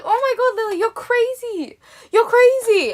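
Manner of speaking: high-pitched, delighted exclaiming; happy amazement. Retold or imitated. Mum on the phone to her daughter, delighted amazement. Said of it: "she was really happy about it".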